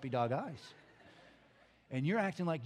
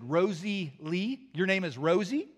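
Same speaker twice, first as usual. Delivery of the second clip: loud, slow disbelieving question; incredulous echo; 3 semitones higher, 7 dB louder, slower than usual. Retold or imitated. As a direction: echo the name as if you can't believe it. Repeats the name in disbelief.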